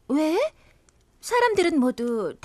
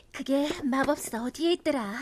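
ordinary delivery

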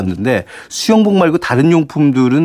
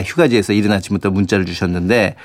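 usual speaking voice

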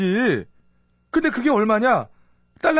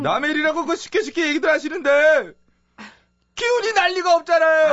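exasperated outburst; 6 semitones higher than usual. Acted. Fugitive scolding the lady for easy sympathy, exasperated. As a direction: build to an aggrieved comic yell.

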